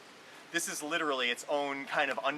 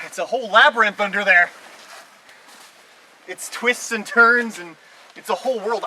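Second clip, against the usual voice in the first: awestruck; 7 semitones higher, 12 dB louder than usual. Real, not acted. Awed by the hidden cave.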